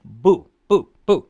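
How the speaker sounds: BU loud, falling sharply from high; short, forceful, falling BU; fourth tone. Shown as a demonstration. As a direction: punch each BU downward. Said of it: "a fourth tone by itself".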